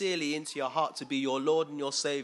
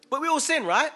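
much higher, loud burst; emphatic exclaim; 10 semitones higher, 8 dB louder than usual. Acted. Preacher exclaiming to the church, high and loud. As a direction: call it out to the room.

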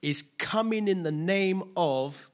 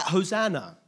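mildly raised, louder quoted cry; 4 semitones higher, 7 dB louder than usual. Retold or imitated. Preacher quoting what the crowd cried out, mild cry. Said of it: "they cried out".

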